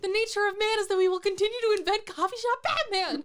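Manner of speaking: loud, high laughing proclamation. Real, not acted.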